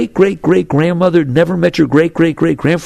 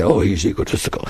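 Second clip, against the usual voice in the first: lower, softer, a scoffing dismissal; mocking judgment; 9 semitones lower, 6 dB quieter than usual. People judging an egotist, in a low mocking scoff. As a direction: sneer it like passing judgment. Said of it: "we say".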